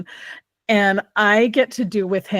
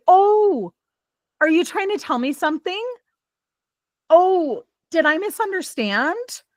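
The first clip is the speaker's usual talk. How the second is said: put-on gentle toddler voice; 9 semitones higher than usual. Mom gently coaxing her fussy toddler, high sing-song.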